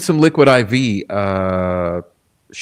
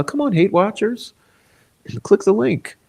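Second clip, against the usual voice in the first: higher, teasing come-on to the hate watchers; mock coaxing; 10 semitones higher than usual. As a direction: lure them in with a sugary high voice.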